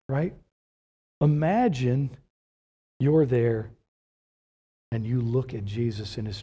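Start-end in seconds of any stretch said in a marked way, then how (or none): none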